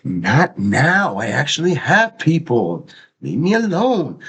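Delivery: rising exasperated brush-off; annoyed. Retold or imitated. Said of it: "And I'm like".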